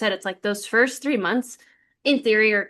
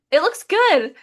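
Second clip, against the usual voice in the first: higher, louder cheerful verdict; doctor's good news; 7 semitones higher, 6 dB louder, slower than usual. Retold or imitated. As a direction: deliver the relief with a bright lift. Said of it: "And he's like".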